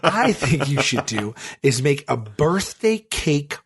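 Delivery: slow, drawn-out mock pitch with a laugh; mock pondering. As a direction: stretch it out towards a grand reveal. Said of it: "And you were talking like this?".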